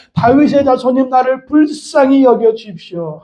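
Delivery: high, drawn-out plea to Jesus; crying out. Acted. Preacher voices Bartimaeus begging Jesus for mercy, crying out. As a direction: call out slowly on a high, sustained pitch. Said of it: "그래서 또 외칩니다".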